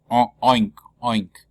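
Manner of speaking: playful teacher's pig noise; pig oink. Shown as a demonstration. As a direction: demonstrate closed O as a pig's oink. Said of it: "como un cochino".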